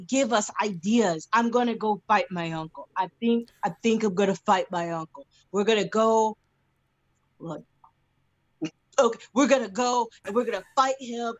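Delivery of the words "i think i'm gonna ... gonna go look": ordinary talk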